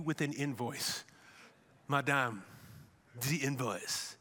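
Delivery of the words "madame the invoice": put-on low, soft French-accented voice; mock French accent, dropped low and quiet. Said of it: "a good French accent".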